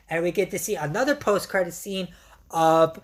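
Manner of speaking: ordinary talk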